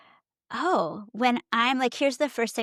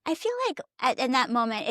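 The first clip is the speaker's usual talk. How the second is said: ordinary delivery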